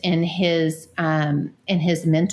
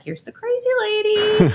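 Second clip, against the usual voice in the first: high, loud mock voice of a wary neighbor; hammed-up neighbor's panic; wary neighbor's thought; 14 semitones higher, 3 dB louder than usual. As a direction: squeak it out as his alarmed inner voice. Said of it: "he's like".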